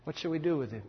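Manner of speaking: hushed, troubled question; subdued question. Acted. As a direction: ask it quietly after a long pause. Same question repeated softly.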